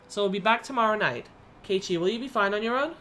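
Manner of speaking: lifted, gentle mother on the phone; a mother coaxing her son to be patient. Acted.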